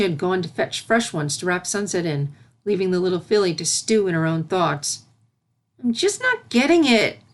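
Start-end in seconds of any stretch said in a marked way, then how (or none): 5.8-7.2 higher, louder whiny grumble; frustrated complaint. A narrator's reading. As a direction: whine it to the empty room. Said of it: "she complained".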